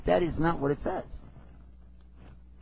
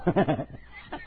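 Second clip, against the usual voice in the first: chuckle. Real, not acted. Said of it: "I'm just kidding".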